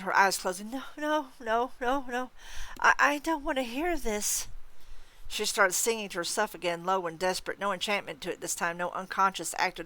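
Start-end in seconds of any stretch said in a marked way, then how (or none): 0.7-4.5 high, moaning ghost woman. A narrator's reading. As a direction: moan it out high, refusing to listen. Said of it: "The spirit moaned".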